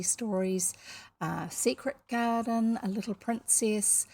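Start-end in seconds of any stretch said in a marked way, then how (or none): none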